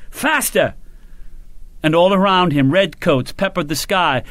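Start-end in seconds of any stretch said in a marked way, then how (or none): 0.1-0.7 high shouted order. A narrator's reading. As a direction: yell it again over the gunfire. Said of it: "he shouted".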